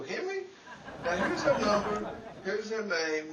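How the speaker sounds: ordinary talk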